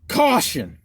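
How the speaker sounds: punchy mock-alarm shout; exclaimed warning. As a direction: call it out like a label's exclamation. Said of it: "Exclamation point".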